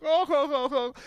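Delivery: crowd reaction. Retold or imitated. Shoppers in the aisle reacting, high oohs. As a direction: ooh it like a scandalized crowd. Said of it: "everyone in the aisle's all like".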